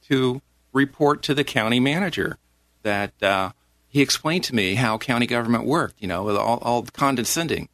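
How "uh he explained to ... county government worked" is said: ordinary delivery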